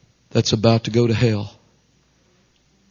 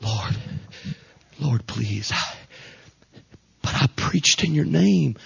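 pleading; slower than usual. Acted. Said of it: "trying to win the case last moment".

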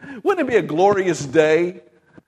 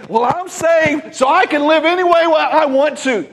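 mocking; 8 semitones higher, 4 dB louder than usual. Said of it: "We want to say".

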